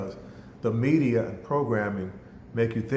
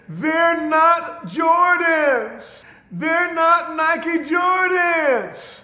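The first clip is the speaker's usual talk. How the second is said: loud, high, drawn-out wailing; crying girl's wail; 6 dB louder, much slower than usual. Retold or imitated. Twelve-year-old to mother about her shoes, acted tearful wail. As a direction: bawl each word like a heartbroken child. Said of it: "the girl started crying".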